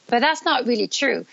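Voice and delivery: ordinary delivery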